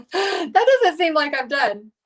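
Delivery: amused laughing repeat. Real, not acted. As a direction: giggle while repeating it. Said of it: "Isn't that funny?".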